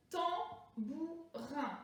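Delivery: "Tambourin" slowly split into three syllables; découpage syllabique. Shown as a demonstration. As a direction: separate each syllable of "Tambourin" for counting. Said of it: "Combien de syllabes?". (« Tambourin » lentement, syllabe par syllabe)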